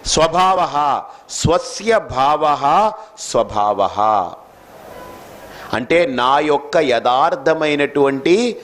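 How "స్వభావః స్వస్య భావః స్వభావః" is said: slow, measured Sanskrit chant; scriptural recitation. Acted. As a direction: intone the verse slowly, syllable by syllable. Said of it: "ఇక్కడ కృష్ణుడు". (నెమ్మదిగా శ్లోక పఠనం)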